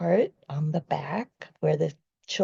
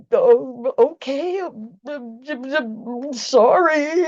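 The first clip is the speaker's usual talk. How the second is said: flustered apology; 7 semitones higher, 3 dB louder, slower than usual. Retold or imitated. Flustered apology to screaming man.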